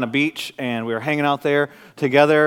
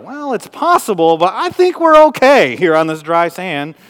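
dry, slow amused reassurance; 4 semitones higher, 8 dB louder, slower than usual. Retold or imitated. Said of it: "And I thought".

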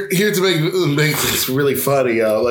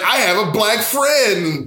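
slow, higher, drawn-out mimicry; mock boasting; 6 semitones higher, much slower than usual. Co-host mocks director's photo with Marlon Wayans, hammed-up boast. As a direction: stretch it out like a hammed-up brag.

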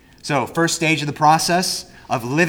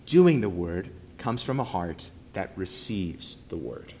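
quieter, fading to near silence on word; hushed echo of the main point; softer repeat; 5 semitones lower, 7 dB quieter than usual. Shown as a demonstration. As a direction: let it sink in, softly.

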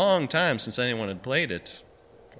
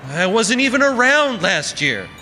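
louder and higher, protesting; voice rising; 11 semitones higher, 7 dB louder than usual. A narrator's reading. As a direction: push it up in exasperation. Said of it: "Barney's voice rose".

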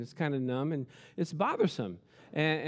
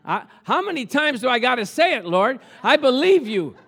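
loud, high, pleading prayer; raised preacher's plea, stretched near the end; crying out to the Lord; 8 semitones higher, 8 dB louder than usual. Retold or imitated. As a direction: cry out to God, impatient and loud. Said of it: "And so I'm crying out to the Lord".

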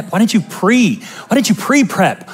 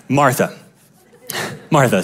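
much lower, gentle, calm call; low, patient, call her name like the Lord; Jesus' voice; 8 semitones lower, 4 dB quieter than usual. Acted. Jesus calling Martha back, low and gentle. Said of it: "I was trapped in the alley voice".

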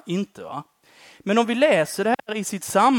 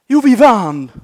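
loud, high joyful shout; triumphant cheer; 7 semitones higher, 13 dB louder, slower than usual. Retold or imitated. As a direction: shout the win like a proud kid. Triumphant kid after match. (jublande, högt rop)

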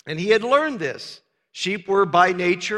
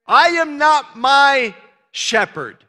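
"not" hit hard, high and loud; slow, punch the word not; slow emphatic denial; stress on not; 6 semitones higher, 5 dB louder than usual. Acted.

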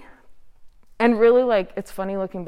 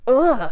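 higher, louder groan of self-disgust; exasperated groan; 5 semitones higher, 6 dB louder than usual. Retold or imitated. Looking back in shame at her behaviours, exasperated groan. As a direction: let out a short, fed-up "ugh".

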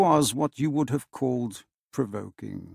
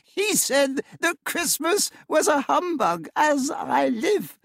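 much higher, laughing exclaim; laughing cry; 14 semitones higher than usual. A narrator's reading. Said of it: "cried Scrooge's nephew".

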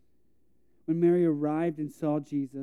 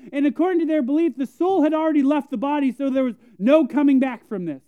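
loud, raised preaching at full force; 9 semitones higher, 10 dB louder than usual. Acted. Preacher pressing the point about faith, loud and high. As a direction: drive the point home to the congregation.

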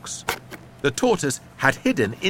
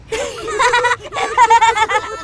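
put-on children laughing; 7 dB louder than usual. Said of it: "Laughed the kids".

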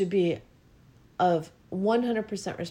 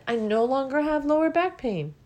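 higher, bright announcement; triumphant news; 7 semitones higher, 3 dB louder than usual. Real, not acted. Speaker sharing her healed back, triumphant. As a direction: proclaim the good news. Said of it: "And guess what?".

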